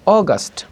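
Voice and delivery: "অগাস্ট" opening on a round O, "AW-gast"; correct English pronunciation. Shown as a demonstration. Teacher modelling August for learners, round-O "অগাস্ট". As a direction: say "অগাস্ট" with O first, then "gast". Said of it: "not আগস্ট".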